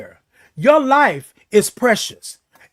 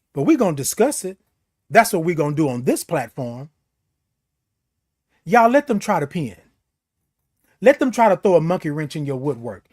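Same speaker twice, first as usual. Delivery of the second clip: usual speaking voice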